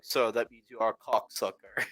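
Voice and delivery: low, quiet mock Russian accent; thick Russian accent. Drunk Russian insulting the storyteller, mock Russian accent. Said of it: "in the most thick Russian accent".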